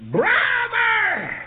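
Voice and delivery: very high, loud holler; full-voiced, piercing imitated yell; hollering for help. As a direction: holler it out for big brother to come running. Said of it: "And he hollered".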